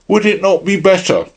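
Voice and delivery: secretary cutting in a little sharply. A narrator's reading. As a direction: press the question sharply at the President. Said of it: "he said a little sharply".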